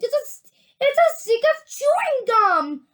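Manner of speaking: high, loud, thrilled cry; excited shriek. A narrator's reading. Gum-chewing girl identifies the strip, excited shriek. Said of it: "she shrieked".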